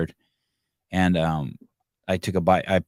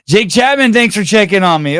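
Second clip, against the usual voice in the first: loud thanks; 13 semitones higher, 11 dB louder than usual. Real, not acted. Thanking a fan for checking in.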